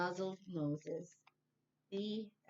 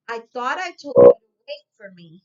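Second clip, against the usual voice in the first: scolding; 18 dB louder than usual. Acted. Lisa scolds bear.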